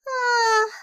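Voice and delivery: a loud wistful "ah"; sigh. A narrator's reading. Envious mouse alone in his hideout sighing. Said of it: "he sighed".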